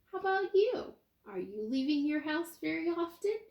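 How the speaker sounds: normal talking voice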